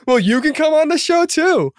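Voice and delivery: very high, hammed-up squeal of an offer; over-excited invitation. Host begging the band onto the show, over-excited. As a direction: squeak it out eagerly.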